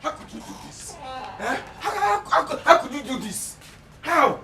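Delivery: high-pitched, stammering reproach; appalled disbelief. Acted. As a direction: squeal it in shocked disbelief. Appalled spouse reproaching partner.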